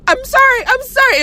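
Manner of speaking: put-on high little-boy apology; apologetic little boy. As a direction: squeak out a quick sorry as the kid in the ghost costume. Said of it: "the little boy was like".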